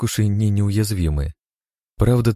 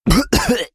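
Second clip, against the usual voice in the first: high wet cough, choking on liquid; 3 dB louder than usual. Acted. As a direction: cough up the slime. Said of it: "продолжая захлёбываться отвратной вонючей жижей". (захлёбывающийся кашель)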